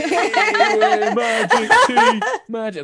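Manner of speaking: very high, loud laughing cheer; cheering soldiers. Acted. Rowdy soldiers cheer the sparkling magic tea, shrieking with laughter. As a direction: whoop it out like rowdy hooligans.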